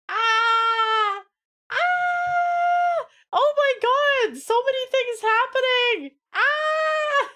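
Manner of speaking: high, loud excited screaming; overwhelmed squeals of excitement; excited shrieks. Real, not acted. Game launch chaos.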